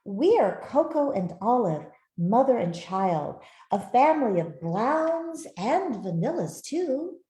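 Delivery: ordinary delivery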